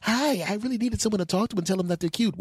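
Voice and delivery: high, quick imitation of a woman; damsel voice. Woman telling S-Man her need, girlish.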